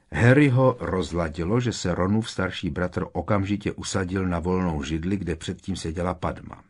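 ordinary delivery

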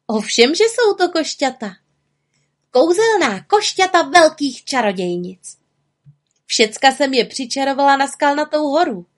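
high, energetic witch voice; lively high-pitched confirmation; proud little witch. Acted. Witch boasts of her magic.

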